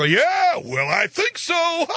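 high, slow, laughing chatter; mock giggly TV voice. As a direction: giggle it like silly hosts. Imitating mocking voices with laugh.

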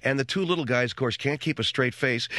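usual speaking voice

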